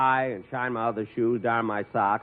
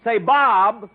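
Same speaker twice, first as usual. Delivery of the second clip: loud, higher call to the whole cast; 7 semitones higher, 5 dB louder than usual. Acted. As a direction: announce it to everybody.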